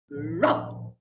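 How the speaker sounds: low growled bark imitation; a deep, rough dog's woof. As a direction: growl it like a dog. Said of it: "a different sound that came".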